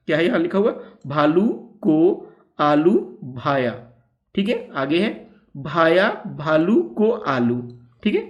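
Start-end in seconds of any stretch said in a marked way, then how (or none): 1.1-3.7 tongue twister spelled out slowly, word by word; slow careful reading. Shown as a demonstration. Teacher reads the tongue twister slowly to pupils. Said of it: "पहले एक बार पढ़ते हैं". 5.6-7.6 tongue twister spelled out slowly, word by word; slow careful reading. Shown as a demonstration. Teacher reads the tongue twister slowly to pupils. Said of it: "पहले एक बार पढ़ते हैं".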